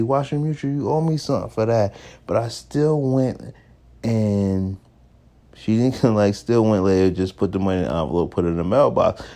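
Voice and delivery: usual speaking voice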